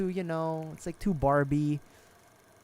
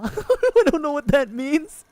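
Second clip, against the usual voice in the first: very high, loud, slow laughing squeal; laughing hard; 13 semitones higher, 11 dB louder, slower than usual. Real, not acted. Laughs at own fancy phrase.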